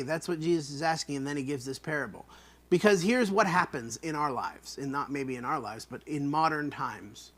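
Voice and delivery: normal talking voice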